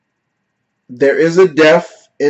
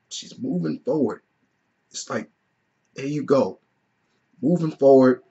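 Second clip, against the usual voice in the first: real emotion, softly spoken; getting emotional; 6 dB quieter than usual. Real, not acted. Reviewer reliving the scene that made him tear up, getting a little emotional. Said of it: "it's getting a little emotional right now".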